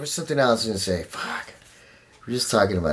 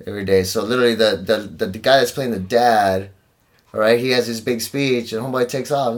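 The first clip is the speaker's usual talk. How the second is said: ordinary talk, nothing marked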